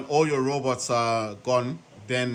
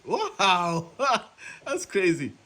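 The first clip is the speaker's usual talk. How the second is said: amused wow; 5 semitones higher, slower than usual. Real, not acted. Amazed reaction, laughing.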